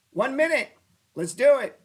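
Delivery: sudden high, loud burst of excitement; excited. Real, not acted. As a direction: jump in, pumped to trade right now. Excited about a quick trade.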